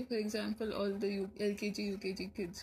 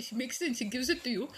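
normal talking voice

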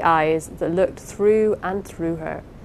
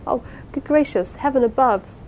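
higher, flustered wail; distracted cry; 6 semitones higher than usual. A narrator's reading. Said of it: "addressing herself distractedly to the ceiling".

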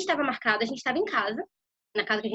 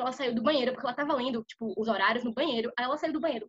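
normal talking voice

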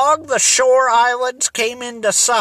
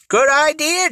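higher-pitched, keen agreement; exclaimed approval; 6 semitones higher than usual. A narrator's reading. Jerry backs the plan to take the boat, exclaiming. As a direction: jump on the idea with mild excitement. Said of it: "Jerry exclaimed".

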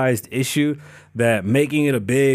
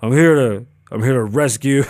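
louder mock macho bounty hunter; lightly voiced tough guy at the door; deep voiced Dog; 4 dB louder than usual. Mimicking Dog the Bounty Hunter at your door, mock deep voice. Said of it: "All deep voiced and shit".

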